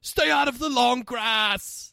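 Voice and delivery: slow, high quoted yell; movie quote shout. Retold or imitated. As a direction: shout the film line, hammed-up. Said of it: "the one line in it that's".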